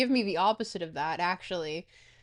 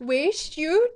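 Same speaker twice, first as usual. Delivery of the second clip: high, loud puzzled echo of "Waste you" with a rising end; mock Toronto slang; 6 semitones higher, 7 dB louder than usual. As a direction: repeat "Waste you" as a baffled question. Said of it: "Kyou no kotoba wa waste you".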